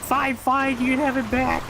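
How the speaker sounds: high, put-on squeal of giving in; squeaky exasperated yielding; mock screaming surrender. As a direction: imitate frantic surrender without real volume. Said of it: "Noodle Snick was screaming".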